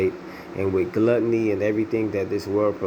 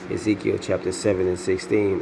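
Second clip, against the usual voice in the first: ordinary talk, nothing marked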